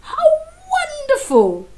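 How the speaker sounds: high, thrilled princess exclamation; delighted cry. Acted. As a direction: squeal it with joy. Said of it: "the princess cried".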